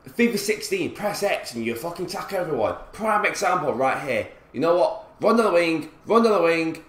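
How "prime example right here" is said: ordinary talk, nothing marked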